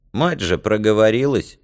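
usual speaking voice

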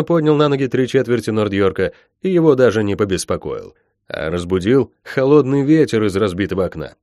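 usual speaking voice

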